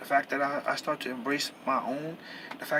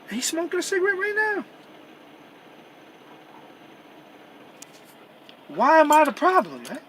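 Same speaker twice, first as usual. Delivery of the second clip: much higher, loud exasperated shout; real angry outburst; 11 semitones higher, 8 dB louder than usual. Real, not acted. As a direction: blow up in frustration at the injustice. Angry rant about unfair treatment.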